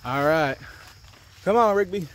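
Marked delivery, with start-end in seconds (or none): 1.4-2.1 calling the dog. Real, not acted.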